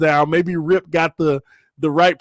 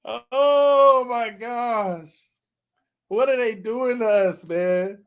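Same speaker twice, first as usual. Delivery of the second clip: laughing disbelief; 5 semitones higher, 4 dB quieter, slower than usual. Real, not acted. Laughing at the name.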